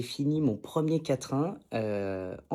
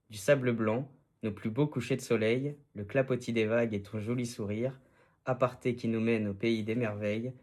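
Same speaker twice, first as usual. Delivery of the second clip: poem reading; 4 semitones lower than usual. Real, not acted. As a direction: recite tenderly, line by line. Reads his love poem aloud.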